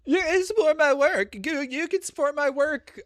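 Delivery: high, loud sneering parody of the plug; mocking imitation. Mocking the creator's Patreon plug.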